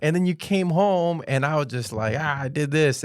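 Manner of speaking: high, exasperated rebuttal; hammed-up protest; indignant comeback. Retold argument back at father.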